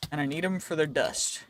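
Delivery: usual speaking voice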